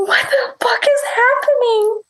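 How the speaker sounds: high, incredulous imitation; mock bewildered squeal; retold disbelief. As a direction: replay stunned bewilderment in a high voice. Retells standing stunned amid chaos.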